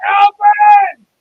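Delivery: loud exasperated yell, high; shouting Alvin. Retold or imitated. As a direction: bellow the name in exasperation. Calling Alvin like the Chipmunks cartoon.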